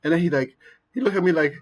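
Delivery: usual speaking voice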